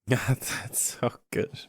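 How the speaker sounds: words breaking into laughter; amused, laughed-out praise; laughing. Real, not acted. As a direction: say it through helpless laughter, slowly. Cracking up at the joke.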